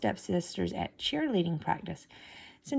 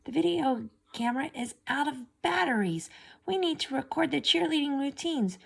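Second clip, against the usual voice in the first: higher, demanding voice; bossy stepmother; 6 semitones higher than usual. A narrator's reading. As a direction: order her around impatiently. Stepmother orders Cinderella.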